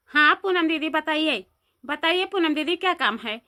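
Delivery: normal talking voice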